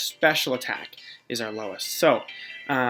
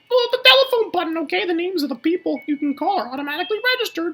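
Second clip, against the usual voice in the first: very high, put-on falsetto; game mom voice; 13 semitones higher, 5 dB louder than usual. Mom teaching how to make calls, high falsetto mom voice. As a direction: gush in a high, motherly character voice.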